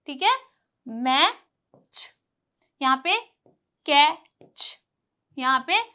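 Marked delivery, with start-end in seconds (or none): 0.9-2.1 "मैच" slowly, split as mae... ch; "मैच" sounded out in two pieces; broken into sounds. Shown as a demonstration. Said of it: "च का sound देता है". 3.8-4.8 "कैच" split as kae... ch; "कैच" louder, sounded out in two pieces; broken into sounds. Shown as a demonstration. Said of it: "च का sound देता है".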